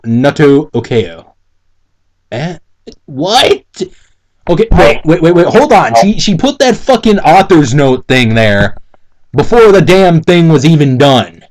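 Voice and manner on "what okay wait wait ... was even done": normal talking voice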